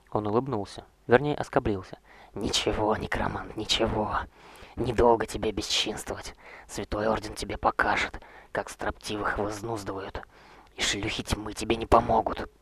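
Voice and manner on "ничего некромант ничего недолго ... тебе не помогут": lightly voiced threatening knight; a hint of sneering menace; menacing zealot. Roger threatens the necromancer.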